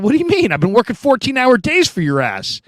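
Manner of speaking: put-on young player protesting to his coach, high and indignant. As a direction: squeal back in outraged disbelief.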